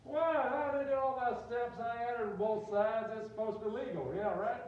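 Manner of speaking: loud, high, drawn-out mock outrage; indignant protest. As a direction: argue back like a wronged Southern student. Student protests to mock cop.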